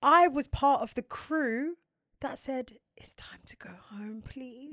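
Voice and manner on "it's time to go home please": whisper. Retold or imitated. Whispered aside about leaving.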